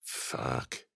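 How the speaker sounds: low, soft muttered curse. A narrator's reading. Ben muttering as the truck arrives, quiet. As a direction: mutter it under the breath. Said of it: "Ben muttered".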